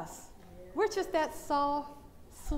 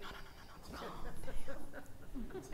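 whispered "no, no", much softer; hushed whisper; 8 dB quieter than usual. Retold or imitated. Elderly congregation whispering to her to calm down. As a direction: hush her like old folks in church. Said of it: "but they were like".

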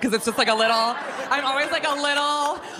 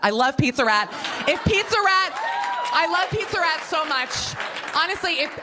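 excited gushing; 6 semitones higher than usual. Real, not acted. Declaring love for Pizza Rat to the crowd, laughing excitement. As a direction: squeal your love for the rat.

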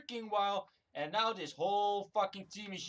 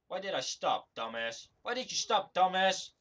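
normal talking voice

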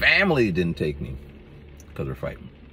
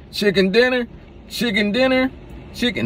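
goofy sing-song voice. Retold or imitated. Silly answer to spam calls.

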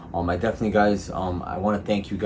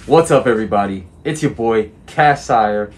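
hyped intro; 2 semitones higher, 9 dB louder than usual. Real, not acted. Hyped vlog intro greeting.

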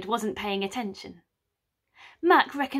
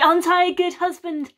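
higher and louder, proud boast; crowing; 7 semitones higher, 7 dB louder than usual. Acted. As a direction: crow with triumph. Mac boasting to wife.

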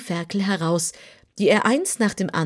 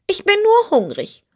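much higher, louder kitten voice, yawned out; yawning kitten; 6 dB louder than usual. Acted. Kitten answers the piglet while yawning. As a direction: yawn through it like a bored cat. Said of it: "erwiderte das Kätzchen gähnend".

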